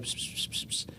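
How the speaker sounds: unvoiced psst whispering noises, very soft; imitated whisper. Staff sergeant whispers to first sergeant, mock psst sounds. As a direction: make the sound of someone whispering in an ear.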